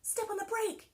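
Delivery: high, loud urgent shout; alarmed yell. Acted. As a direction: yell at your sister to stop. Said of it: "yelled Jack".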